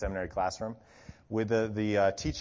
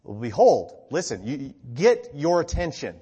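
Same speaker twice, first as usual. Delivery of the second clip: punched, attention-grabbing BEHOLD; emphatic proclamation; 6 dB louder than usual. Retold or imitated. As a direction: hit BEHOLD hard to seize attention. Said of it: "stated in a more emphatic way".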